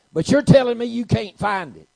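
very loud, high, sustained disbelief; incredulous outburst. Retold or imitated. Patient challenging doctor who can't find cancer, shouted incredulously. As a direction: throw the contradiction back at full volume.

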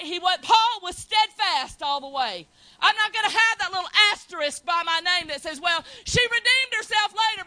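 loud, rising sermon cry; full-force preaching. Real, not acted.

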